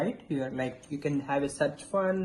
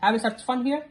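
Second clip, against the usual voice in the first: higher, coaxing parent voice; talking to a toddler; 8 semitones higher than usual. Acted. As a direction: invite a toddler to press. Parent prompting toddler with numbers.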